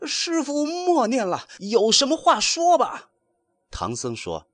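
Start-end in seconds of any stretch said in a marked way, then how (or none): 0.1-3.1 high, pained plea to stop; pained pleading. A narrator's reading. Wukong in pain begs his master, high pleading. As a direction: beg the master to stop the spell. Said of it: "滚过来哀告".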